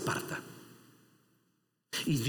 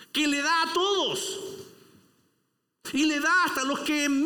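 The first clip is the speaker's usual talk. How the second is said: preaching at full force; 8 semitones higher, 3 dB louder than usual. Acted. Preacher exposing envy to his church, preaching at full force. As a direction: rise to a fiery climax. Preaching climax with mock prayer.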